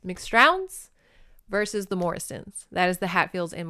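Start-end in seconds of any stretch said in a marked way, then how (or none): 0.1-0.9 "McStrowns" higher, rising like a question; unsure pronunciation. Real, not acted. Narrator retrying the clan name for Scottish people, unsure. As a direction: try "McStrowns" tentatively, pitch lifting at the end. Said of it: "I'm saying that terribly".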